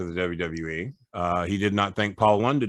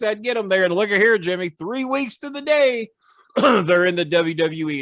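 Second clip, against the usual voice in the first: rising, higher and louder promo gloat; wrestling promo; 7 semitones higher, 3 dB louder than usual. Retold or imitated. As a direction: build the gloat until you are crowing. Retelling his wrestling promo on camera.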